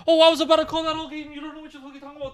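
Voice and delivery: fast, high mocking voice; mimicked opponent. Imitating the soccer opponent talking back, high rapid mock voice. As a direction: rattle it off high, mocking a rival.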